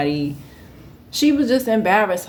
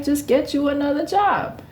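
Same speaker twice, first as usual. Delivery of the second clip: higher, dismissive comeback; sassy retort; 8 semitones higher than usual. Retold or imitated. Teen telling Diane to find work, a touch sassy. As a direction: brush her off with attitude. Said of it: "I'm like".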